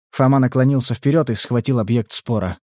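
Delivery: ordinary delivery, nothing marked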